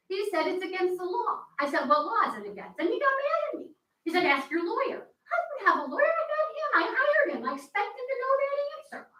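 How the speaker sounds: indignant, building outrage as she retells it; frustrated, heated. Real, not acted. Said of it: "I'm getting heated".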